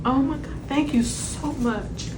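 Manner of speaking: real tears, high and shaky; ugly crying. Real, not acted. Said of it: "I'm not gonna ugly cry".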